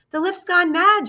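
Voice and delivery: high, loud alarm; frightened shout. Acted. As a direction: cry out in alarm. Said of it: "shouted Grandpa Joe".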